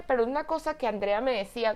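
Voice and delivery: ordinary delivery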